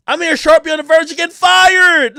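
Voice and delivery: loud exclaiming outburst; shouting. Real, not acted. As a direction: blurt it out loud.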